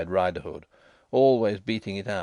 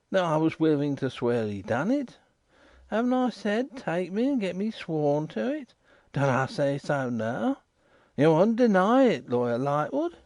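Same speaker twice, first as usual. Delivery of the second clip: lightly voiced waterside informer, insistent; a rough riverside man pressing his point; Riderhood; 7 semitones higher than usual.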